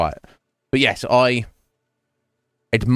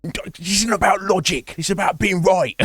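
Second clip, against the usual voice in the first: high-pitched ranting caricature; mock outrage; 9 semitones higher than usual. Host mimicking people who can't stand disagreement, mock-outraged. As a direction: squawk it like an irrational hothead. Mimicked hothead rejects logic.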